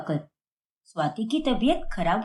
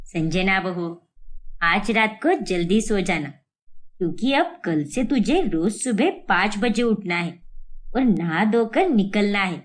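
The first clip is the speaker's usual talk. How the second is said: bossy mother-in-law; 6 semitones lower than usual. Acted. Mother-in-law giving orders.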